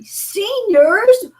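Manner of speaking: high, loud teasing disbelief; mock the mom for dating old; teasing kids mocking mom's senior dating site. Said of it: "my kids were making fun of me".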